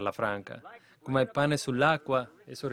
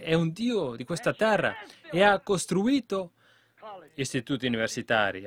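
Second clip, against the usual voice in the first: usual speaking voice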